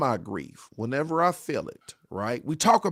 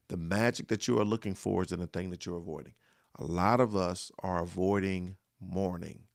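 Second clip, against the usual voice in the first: ordinary delivery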